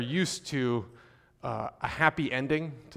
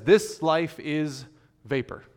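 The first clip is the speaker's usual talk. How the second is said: this life is drawn out slowly, pause before vapor; slow and weighty, a pause before vapor; slow solemn quote; 4 dB louder, slower than usual. Shown as a demonstration. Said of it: "where the preacher says".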